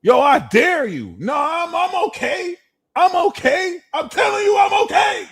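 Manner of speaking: high, loud, insisting shout; hammed-up hothead denying being upset; defensive fire sign. Fire sign insisting they're okay, loud and high. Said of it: "They're like".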